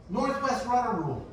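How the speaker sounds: high mock hail from another boat; imitated call-out. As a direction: call it out like a bluffing sailor. Imitates rival sailor calling out rule.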